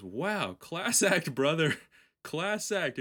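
high, loud sarcastic jab; sarcastic praise. Retold or imitated. Sarcastic parting shot.

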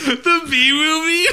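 incredulous laugh. Real, not acted. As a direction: laugh out the question in disbelief. Mocking partner's guess, laughing.

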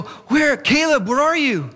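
high, loud, urgent call; retold calling out. Dad stops to call his hidden son, frantic. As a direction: re-enact a frantic parent searching.

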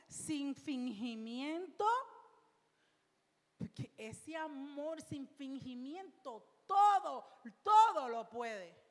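slow, swelling to a loud climax; rising slow sermon crescendo; preaching crescendo. Acted. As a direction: build to a triumphant proclamation.

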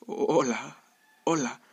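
hesitant, stuttered hello; stammering. A narrator's reading. Trembling Jeremías answers the beggar, stammering. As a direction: stumble nervously into the greeting and retry it. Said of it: "dijo tartamudeando".

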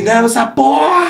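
high-pitched mock shout with laughter in it; re-enacted victory yell. Player retelling his cry after the goal, mock victory yell. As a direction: yell it high, reliving the win. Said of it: "eu só gritava assim".

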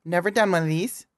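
high bubbly tutorial host voice; chirpy beauty vlogger intro; makeup tutorial host. Retold or imitated. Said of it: "every YouTuber".

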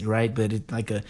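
ordinary talk, nothing marked

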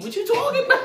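incredulous. Real, not acted. Protesting, laughing, pitch jumps up.

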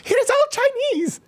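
mocking the jump up an octave, very high and slow; very high, mocking mimicry; octave-up imitation. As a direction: squeak it way up high in mock outrage. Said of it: "why does your voice go up an octave".

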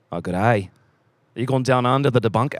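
mock Australian or New Zealand accent. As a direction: put on a joking Down Under accent. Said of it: "talk back to them in that accent".

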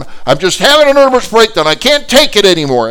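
high-pitched loud yelling; upset, mad outburst. Retold or imitated. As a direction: act a woman beside herself. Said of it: "she's upset. She's mad. She's really beside herself".

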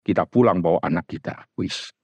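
ordinary delivery

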